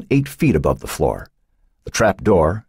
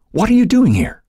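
alarmed; 4 dB louder than usual. A narrator's reading. Shocked boy finds friend captive.

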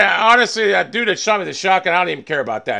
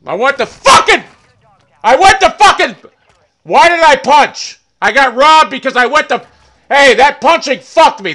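loud, high-pitched angry outburst; furious shouting; 7 semitones higher, 6 dB louder than usual. Real, not acted. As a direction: yell it, really pissed off at the mistake. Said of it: "I'm fucking pissed off".

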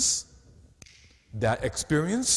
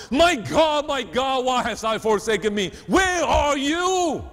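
high, loud anguished cry; crying out to God; 12 semitones higher, 7 dB louder than usual. Acted. Preacher voicing Jesus on the cross, crying out loud. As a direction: call out as if forsaken. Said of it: "Jesus cried out".

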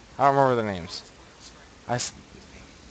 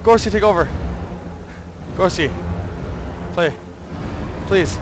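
shouted, much higher and louder; panicked plea; 7 semitones higher, 5 dB louder than usual. Real, not acted. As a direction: yell for help, still panicking. Begging friend to take over.

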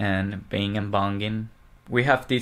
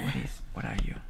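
breathy whisper to the cat; 13 dB quieter, much faster than usual. Real, not acted. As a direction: whisper it softly. Whispering to a pet.

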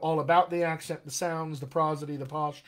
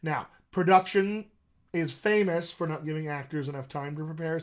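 normal talking voice

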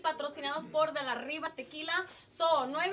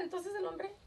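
ordinary talk

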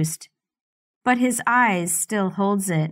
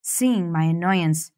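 usual speaking voice

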